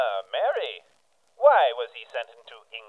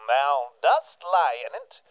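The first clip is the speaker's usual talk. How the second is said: ordinary talk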